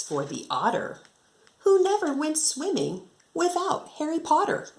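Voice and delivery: lively singsong storybook reading; reading to children. Acted. Teacher reading a rhyming picture book to her class, lively and singsong. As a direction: bounce the rhyme for young listeners.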